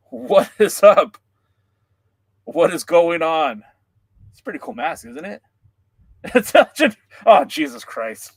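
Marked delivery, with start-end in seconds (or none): 0.1-3.6 slow, loud wrestler-persona greeting; loud masked wrestler hello. Pretend. 6.4-8.3 laughing fit. Real, not acted. Breaks down laughing in mask.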